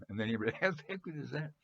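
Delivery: fast, high, laughing through the words. Real, not acted. Telling viewers a funny [name] story, laughing. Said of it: "it's just so funny".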